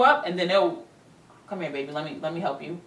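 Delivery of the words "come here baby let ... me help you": soft, softer coaxing voice imitating the abuser; mock sweet talk. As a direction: soften it like a fake apology, coaxing. Abuser sweet-talking after violence.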